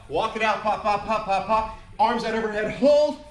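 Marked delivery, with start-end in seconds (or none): 2.8-3.3 loud high call, hold it. Real, not acted. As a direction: shout the hold to the class. Instructor calling out hold.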